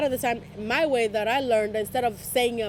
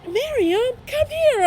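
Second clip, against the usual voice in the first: much higher, louder calling voice; quoting friend Dana; 13 semitones higher, 5 dB louder, slower than usual. Retold or imitated. Dana calling the speaker over, higher and louder. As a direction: call her over, pitched way up. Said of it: "she's like".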